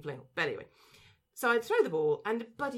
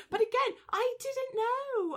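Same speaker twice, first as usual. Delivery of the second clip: high, louder, drawn-out plea of innocence; playful protest; 14 semitones higher, 4 dB louder, slower than usual. Real, not acted. Dog owner defending past ball-flinging to the trainer, mock protest. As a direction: protest it loud and high, stretching the words.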